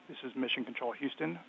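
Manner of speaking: ordinary talk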